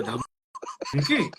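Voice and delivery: stretched slow mocking question with a laugh; mock surprised. Girl mocking the boy's exam threat, slow and amused. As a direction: drag it out, amused and daring. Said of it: "તો કે".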